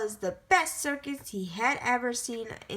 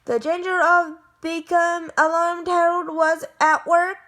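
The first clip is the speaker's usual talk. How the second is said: louder and higher, racing to tell the danger; excited storytelling; 6 semitones higher, 6 dB louder than usual. A narrator's reading. Narrator telling listeners of the loose lion, excited and urgent. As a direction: pump up the urgency as the hero rushes in.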